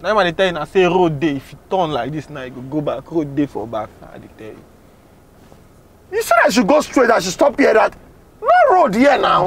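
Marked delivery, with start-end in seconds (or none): 6.1-9.4 exasperated protest. Acted. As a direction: protest to the passenger that you only obeyed. Driver protests there is no road.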